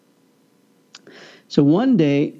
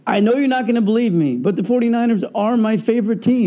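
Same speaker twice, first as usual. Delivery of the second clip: higher and fast, bursting with fan glee; excited; 7 semitones higher, faster than usual. Retold or imitated. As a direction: blurt it out like a thrilled fan. Excitedly tells fellow fan.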